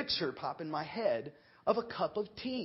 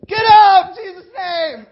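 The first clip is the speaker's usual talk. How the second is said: high, very loud, slow rebuke; yelling command; 24 dB louder, slower than usual. Retold or imitated. Pastor yelling at the demon to leave Paul. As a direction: bellow it at the demon. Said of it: "Let's yell at the demon".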